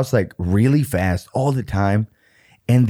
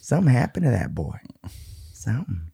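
spooky hush; 7 dB quieter, faster than usual. Pretend. Spooky story about the neighbor.

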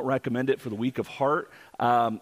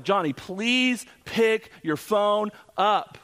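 slow, high-pitched begging, word by word; desperate plea; 7 semitones higher, much slower than usual. Retold or imitated. Teenager beeping his brother-in-law, hammed-up desperate plea. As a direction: imitate urgent pleading, drawn out.

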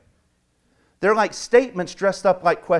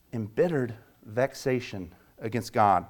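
quiet repeat; 5 semitones lower, 6 dB quieter than usual. Shown as a demonstration.

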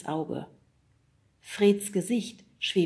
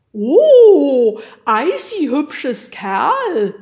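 very loud, high crooning creature voice; fluting yeti; 8 semitones higher, 16 dB louder, much slower than usual. A narrator's reading. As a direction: coo it high and loud in delighted self-admiration. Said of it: "flütete er". (hohes, lautes Flöten eines eitlen Yetis)

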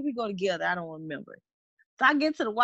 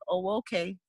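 unimpressed; 7 semitones lower, 5 dB quieter than usual. Retold or imitated. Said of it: "how I look when I just ain't feeling it".

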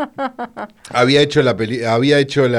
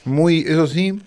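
chewing while talking; 5 semitones higher, slower than usual. Real, not acted. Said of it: "Perdón, estoy comiendo caramelos".

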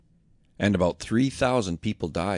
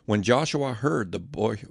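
plain reading voice

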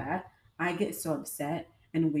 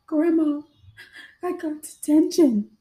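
high, loud wail to grandma; mock crying; 9 semitones higher, 9 dB louder than usual. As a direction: imitate your own tearful, drawn-out confession. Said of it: "Why are you crying?".

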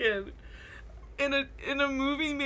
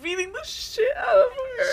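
laughing; 13 semitones higher, 6 dB louder than usual. Real, not acted. Laughing while retelling.

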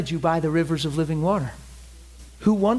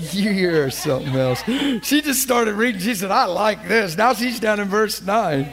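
talking through laughter; amused teasing; 4 semitones higher than usual. Real, not acted. Preacher teasing a woman who kept reading, amused. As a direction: tease the reader with a laugh.

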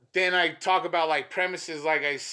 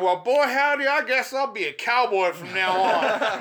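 cowboy voice; 7 semitones higher, 6 dB louder than usual. Retold or imitated. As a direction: boom it out like a corny cowboy. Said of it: "I did my cowboy voice".

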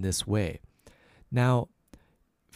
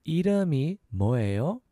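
이름이 뭐예요 in two quicker pieces, rising; a little faster; 4 semitones higher, much faster than usual. Shown as a demonstration. Teacher asking "what's your name", a little faster. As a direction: join the syllables of 이름이 뭐예요. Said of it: "So a little faster, that's".

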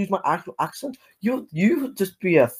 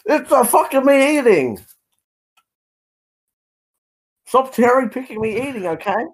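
indignant protest; 9 semitones higher than usual. Real, not acted. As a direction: protest in a raised, exasperated pitch. Heated protest during a debate.

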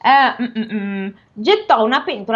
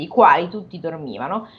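plain reading voice

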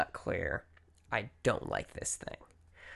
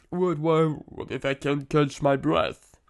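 mock panic; 2 semitones higher, 7 dB louder, slower than usual. Acting out an anxious what-if spiral.

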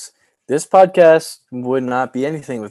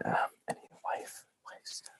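whispering; 22 dB quieter than usual. Pretend. Trailing joke aside.

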